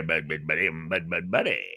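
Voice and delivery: lightly voiced radio DJ catchphrase; Grease Man catchphrase. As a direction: do the DJ's signature call. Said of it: "imitating the Grease Man catchphrase".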